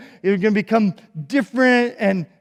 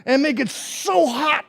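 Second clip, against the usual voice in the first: high, loud slow raging roar; furious king; 7 semitones higher, 4 dB louder, slower than usual. Acted. King ordering guards, furious slow roar. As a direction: tear into them. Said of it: "he tore into them and he said".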